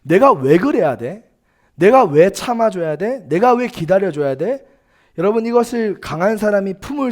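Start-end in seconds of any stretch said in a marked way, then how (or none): 0.0-4.6 loud, protesting questions; indignant complaint. Acted. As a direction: voice an acted grievance, pushing each "why". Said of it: "속으로 품을 법한 불만입니다".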